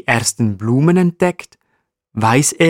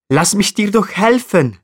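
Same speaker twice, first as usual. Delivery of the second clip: high, eager offer of help; high little marmot appeal; pleading offer; 9 semitones higher than usual. A narrator's reading. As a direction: urge them to accept help, voice pitched up. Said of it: "bietet Eddie an".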